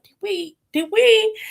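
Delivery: high, climbing disbelief; incredulous did we. Retold or imitated. Suho to Xiumin, high rising disbelief. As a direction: ask it in rising shock.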